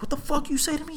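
high-pitched outraged comeback; retold indignant challenge. Bouncer confronts the friend who insulted him, indignant. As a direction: demand it back in a high voice.

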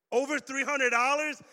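loud, disbelieving question; shocked. Real, not acted. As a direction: react with real shock at the amount. Youth pastor shocked at kid's spending.

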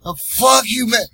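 high, loud, angry curse; drunk snarl. Retold or imitated. Drunk passenger cursing the driver before the punch. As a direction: throw it in his face.

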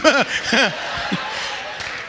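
soft, breathy chuckle; laugh. Real, not acted. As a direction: laugh quietly at your own joke. Laughs at own joke.

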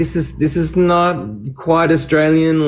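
put-on high Australian accent, slowed; Aussie accent. Voice actor voicing his own Aussie ear. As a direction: drawl it out like a picky Aussie. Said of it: "It's like".

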